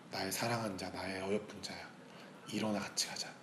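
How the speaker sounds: soft, low-key scripture voice of a lover; tender loving call. Acted. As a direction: call the beloved gently and unhurriedly. Quotes the beloved's loving call. (나직하고 다정한 고백)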